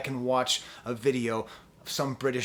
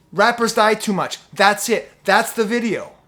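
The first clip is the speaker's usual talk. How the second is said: heated outburst; 5 semitones higher, 7 dB louder than usual. Real, not acted.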